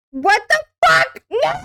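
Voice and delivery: high, loud, slow disbelief; shocked. Real, not acted. Shocked at a Discord DM.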